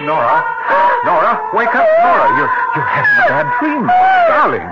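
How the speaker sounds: high, loud, urgent calls to wake her; urgent shouting. Acted. Husband shouting his wife awake from a nightmare, urgent and loud.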